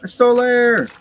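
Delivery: high, loud joyful cry of his name; thrilled fan yelling a name; excited shout. Real, not acted. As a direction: call out the name in real delight. Greeting favourite game character.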